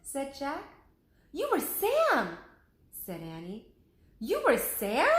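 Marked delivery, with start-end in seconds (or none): 1.3-2.4 high, loud, slow amazed statement; the big discovery; amazed revelation. Acted. Annie realizes Lincoln was Sam: high loud amazed voice. 4.2-5.1 loud incredulous rise; can't believe it; disbelieving question. Acted. Jack echoes Annie in disbelief: loud incredulous question.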